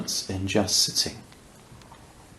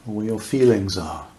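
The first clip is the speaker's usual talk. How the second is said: unhurried meditation voice with long pauses; guided meditation; faster than usual. Real, not acted. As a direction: guide listeners gently into awareness, leaving space between phrases.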